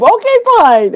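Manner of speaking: high, put-upon "Okay, fine."; grudging okay fine. Retold or imitated. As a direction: give in with a huff. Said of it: "grudging concession".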